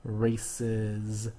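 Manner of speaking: "Races" measured out, softly voiced Z at the end; sounded-out EZ ending. Shown as a demonstration. Teacher filing the word by sound, "Races" drawn out. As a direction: sound out both syllables of "Races". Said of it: "what's it sound like?".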